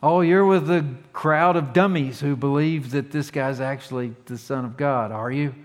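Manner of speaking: mocking condescension, loud at the start then easing; talking down to idiots. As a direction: sneer at them as gullible fools. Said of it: "Talk to 'em like they're idiots".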